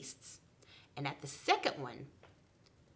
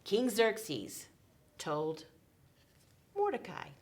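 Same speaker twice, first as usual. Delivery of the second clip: usual speaking voice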